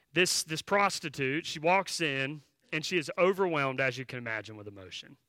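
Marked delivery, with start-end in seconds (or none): none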